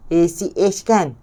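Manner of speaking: ordinary talk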